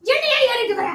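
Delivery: high, heated protest; angry self-defence. Real, not acted. Blamed friend denies doing anything, angry and high-pitched. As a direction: fire back at the accusation. Blamed, snaps back in a quarrel.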